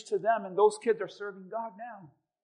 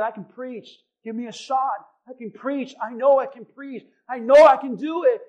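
insistent pleading; 4 semitones higher, 9 dB louder than usual. Retold or imitated. Begging pastor to let him preach.

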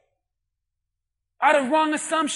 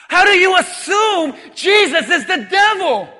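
ordinary talk

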